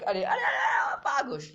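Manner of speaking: high yelling cheer urging the troops on; excited shout. Real, not acted. Streamer hypes his push, yelling loud and high. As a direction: shout it out, pitch way up, all in.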